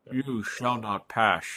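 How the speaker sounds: slow, drawn-out impression of a film wizard; Sean Connery. Podcaster imagining the actor as Gandalf, slow impression. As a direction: stretch it out like an actor's grand line. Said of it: "Can you imagine Vin Diesel and Sean Connery".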